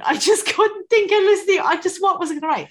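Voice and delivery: high, loud helpless protest; exasperated. Real, not acted. Racking her brain for a sentence with lung, exasperated. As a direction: pitch climbing in comic desperation.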